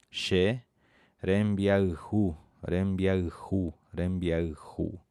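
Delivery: "Xerembiaihu", then "rembiaihu" stretched very slowly; very slow spaced. Shown as a demonstration. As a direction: break the word down slowly. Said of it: "a pronúncia bem pausada".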